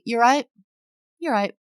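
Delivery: light English accent exchange; mirrored British greeting. Shown as a demonstration. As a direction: echo the greeting back casually. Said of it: "They're looking for a".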